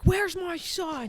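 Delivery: high, slow worried mother's voice; frantic mother. Retold or imitated. A mother arriving to ask where her son is, high and worried. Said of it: "so she's like".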